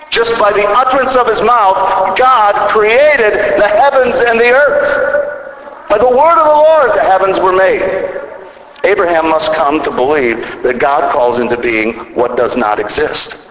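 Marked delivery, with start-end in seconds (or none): 0.1-7.8 full-force preaching. Acted. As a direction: thunder it out like a revival peak. Sermon climax on God's word.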